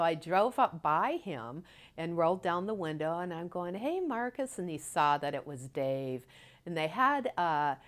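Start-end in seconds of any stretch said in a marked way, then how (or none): 3.7-4.6 high, lilting greeting; pitched-up call from the car; retold friendly hail. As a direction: act out hailing a friend. Retold calling from car window.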